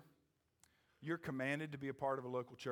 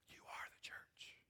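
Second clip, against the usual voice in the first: slow, hushed whisper; whispered secret; 14 dB quieter, slower than usual. Real, not acted. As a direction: whisper it slowly to the congregation.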